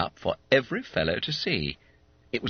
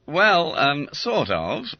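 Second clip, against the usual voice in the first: sheepish admission; 7 semitones higher, 4 dB louder, slower than usual. Acted. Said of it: "admitted Smithy".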